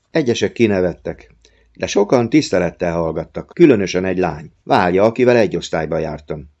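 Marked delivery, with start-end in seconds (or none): none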